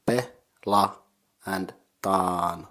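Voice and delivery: "pe", "la", then a long "taan", each apart; syllable by syllable. Shown as a demonstration. Teacher breaking down the second word, "pe, la, and taan" one by one. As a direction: separate "pe", "la" and "taan", drawing out the last. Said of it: "consisting of three syllables".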